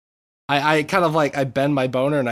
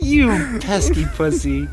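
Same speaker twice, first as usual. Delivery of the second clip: loud, slow, higher scolding; 7 semitones higher, 5 dB louder, slower than usual. Acted. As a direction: scold someone, drawn out and loud. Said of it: "you scolding someone".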